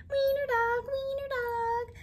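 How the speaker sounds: high singsong playground taunt; jeering nyah-nyah singsong; laughing taunt. A narrator's reading. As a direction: tease him in a high chant. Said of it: "laughed the other dogs".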